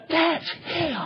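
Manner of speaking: imitating a nervous guard pointing him out; hushed warning.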